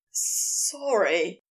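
low, hoarse apology. A narrator's reading. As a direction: rasp out an embarrassed apology. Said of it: "Crowley said hoarsely".

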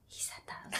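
very soft, breath-only whisper; whispered reaction. Retold or imitated. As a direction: whisper it secretly to a friend. Quoting whispered reaction to friend.

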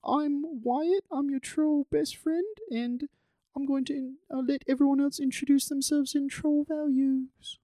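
high, airy fantasy troll voice. Acted. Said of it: "the troll voice".

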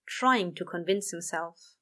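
ordinary delivery

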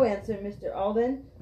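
usual speaking voice

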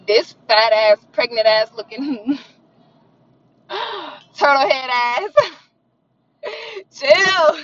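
insults broken by laughter; giggling through the insults; laughing insult. Real, not acted. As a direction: roast him while cracking up.

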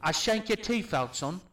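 hammed-up British accent; British accent attempt. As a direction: play a British street tough. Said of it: "do your best British accent".

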